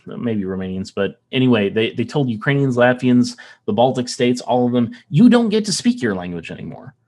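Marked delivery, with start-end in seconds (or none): 5.1-6.9 higher-pitched, emphatic retold order; mock decree. Communists telling Latvians and Ukrainians their language is banned, mock decree. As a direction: imitate an occupier laying down the rule. Acting out occupiers' order to locals.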